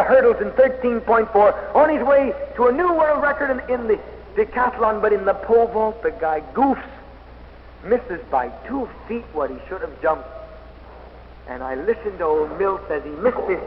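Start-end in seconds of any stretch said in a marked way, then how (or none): none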